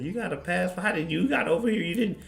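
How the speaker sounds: high, incredulous clerk voice. Retold or imitated. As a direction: sneer each question up high. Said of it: "She would have told Lewis Hamilton".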